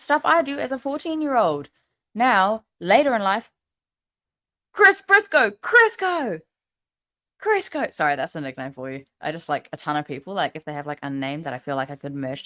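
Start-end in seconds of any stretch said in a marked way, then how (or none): 4.7-7.9 high, drawn-out announcing voice; high sing-song naming. Acted. Announcing a new nickname.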